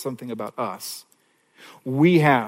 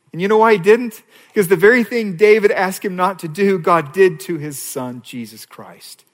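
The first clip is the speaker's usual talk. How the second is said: higher, louder rising appeal; preaching build; 5 semitones higher, 6 dB louder than usual. Real, not acted. Preacher building to the gospel point, higher and louder. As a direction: lift the question to the crowd.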